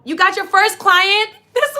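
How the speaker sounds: loud, high, drawn-out cheer; thrilled, slow, high-pitched holler; excited shout. Real, not acted. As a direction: shout the good news, stretching it high. Celebrating a first client's arrival.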